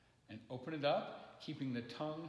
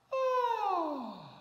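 high falsetto sighing ah; yawn sigh; 8 dB louder than usual. Shown as a demonstration. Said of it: "We're going to just sigh".

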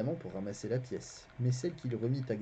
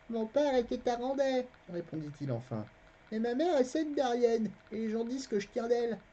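put-on a boy's voice, pitched higher; the narrator voicing the boy Garion, higher; boy lying innocently to a stranger, higher voice; young boy Garion; 12 semitones higher than usual. A narrator's reading.

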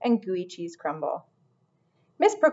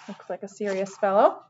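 usual speaking voice

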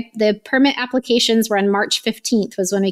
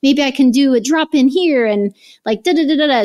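excited planning; 4 semitones higher, 4 dB louder than usual. Retold or imitated. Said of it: "going through all these alternative scenarios".

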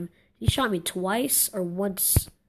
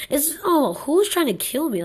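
high, loud protest; indignant outburst; 7 semitones higher, 5 dB louder than usual. Real, not acted. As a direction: demand to know the culprit. Targeted by another player.

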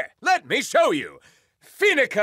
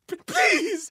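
weeping; 11 semitones higher than usual. Acted. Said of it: "Weeped certified weenie Rick Shades".

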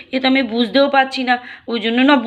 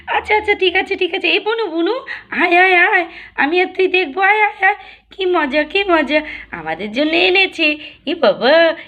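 put-on high excited child's voice; a small girl delighted by presents; little girl voice; 5 semitones higher than usual. Little girl asking her aunt about gifts.

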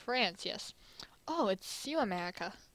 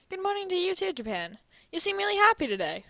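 bright cheerful greeting; 8 semitones higher, 4 dB louder than usual. Acted. America greets Japan brightly.

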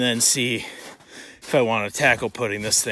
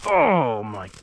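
ordinary talk, nothing marked

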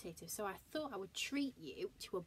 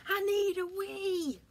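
high, loud, slow desperate wail; desperate bear wail; 13 semitones higher, 8 dB louder, slower than usual. Acted. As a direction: whine it, bursting.

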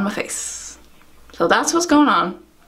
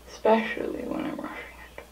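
whisper; 10 dB quieter than usual. Real, not acted. Aside while doing mascara.

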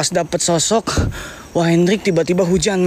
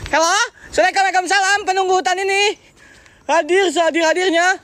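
calling to spirits; 10 semitones higher than usual. Real, not acted.

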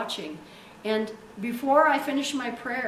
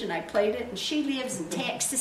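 usual speaking voice

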